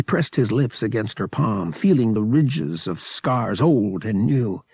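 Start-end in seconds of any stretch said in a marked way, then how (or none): none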